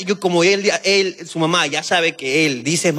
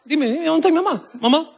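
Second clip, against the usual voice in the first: put-on demanding baby voice; 10 semitones higher than usual. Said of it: "esperando una respuesta".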